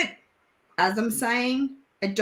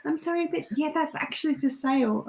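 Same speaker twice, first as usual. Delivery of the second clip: imitated sales assistant; 6 semitones higher, 5 dB quieter, faster than usual. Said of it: "She's like".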